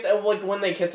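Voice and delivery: ordinary delivery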